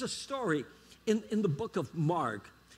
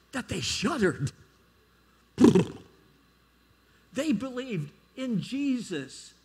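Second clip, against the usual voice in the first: raised, rising preacher's call; preaching at full force; 7 semitones higher, 5 dB louder than usual. Acted. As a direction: drive it home to the congregation.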